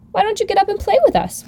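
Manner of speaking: put-on high, curious young rabbit; lightly voiced wild rabbit asking; furry rabbit voice. A narrator's reading. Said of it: "one of them asked".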